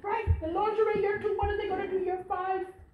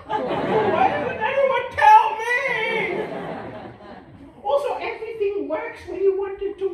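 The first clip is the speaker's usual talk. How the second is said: slow, louder mock-crying lament through laughter; mock wail; 7 semitones higher, 8 dB louder, slower than usual. Older self to nobody, hammed-up tearful wail.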